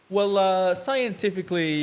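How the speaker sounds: high, loud, put-on expert voice; mock news article. Joking after being told to talk normal, mock news article. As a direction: pretend to read out a scientific report. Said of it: "bit of a news article".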